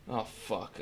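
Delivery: ordinary talk, nothing marked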